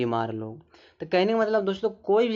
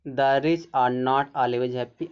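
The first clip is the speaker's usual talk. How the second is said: slow dictation; much slower than usual. Shown as a demonstration. Reading example sentence aloud.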